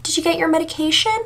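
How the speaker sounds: high, little questioning voice; lilting high question, rising at the end; Carl Jacobs's little tone. Retold or imitated. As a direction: imitate a tiny, high-pitched check-in. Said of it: "like, in that little tone".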